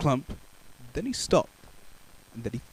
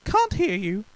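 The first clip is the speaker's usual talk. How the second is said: higher innocent reply; mock deafness; 9 semitones higher than usual. Baker pretending not to hear. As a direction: shrug it off sweetly.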